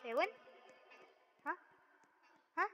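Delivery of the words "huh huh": ordinary talk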